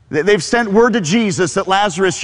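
normal talking voice

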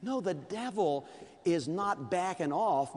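usual speaking voice

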